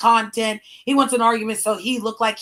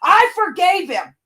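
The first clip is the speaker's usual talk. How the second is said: heated outburst; 9 semitones higher, 6 dB louder than usual. Real, not acted. Heated rant about ex.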